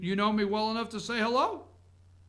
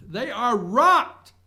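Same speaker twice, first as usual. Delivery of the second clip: rocked stretched slow and louder; stress on rocked; 4 semitones higher, 8 dB louder, much slower than usual. Real, not acted. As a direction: draw out rocked, hitting it hard.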